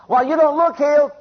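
loud, high-pitched jeer; taunting devil. Acted. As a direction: cast doubt as the devil, loudly. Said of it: "the devil says".

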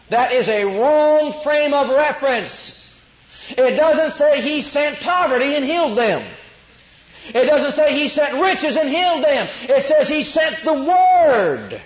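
loud, slow, high pulpit preaching that rises to a shout; fiery preaching. Acted. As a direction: preach it out hard to the congregation. Preaching at full force.

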